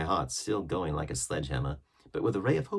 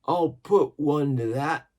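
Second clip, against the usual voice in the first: loud, slow, higher sailor's shout; gruff old seaman cry; 9 semitones higher, 9 dB louder, slower than usual. A narrator's reading. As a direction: bellow it like an old salt. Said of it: "cried the old mahogany-faced seaman Morgan".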